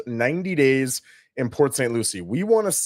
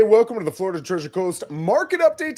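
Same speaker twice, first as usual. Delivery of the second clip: excited greeting; 8 semitones higher, 6 dB louder, faster than usual. Real, not acted. Energetic show opening greeting.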